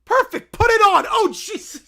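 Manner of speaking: high, loud command; shouted approval. Acted. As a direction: call it out with gusto. Character shouts acted approval.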